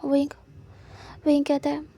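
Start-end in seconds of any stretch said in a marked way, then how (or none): none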